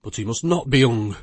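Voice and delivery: a forceful, pleading insistence; vehement. Acted. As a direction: protest hard that he must not hang. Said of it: "with vehemence".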